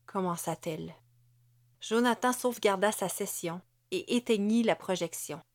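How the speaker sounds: ordinary delivery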